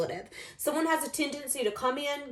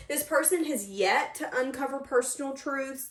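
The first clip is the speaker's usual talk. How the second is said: usual speaking voice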